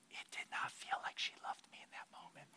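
hushed, confiding whisper; quiet aside to the congregation. Real, not acted. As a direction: drop to a whisper as if sharing a secret. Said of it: "whispered aside".

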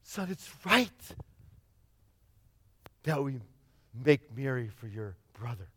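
soft, low tender explanation; gentle father. Acted. Father explaining to his older son, soft and tender. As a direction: ease the son down quietly.

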